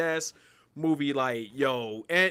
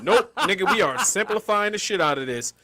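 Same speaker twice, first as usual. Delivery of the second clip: laughing refusal; 7 semitones higher, 8 dB louder than usual. Pretend.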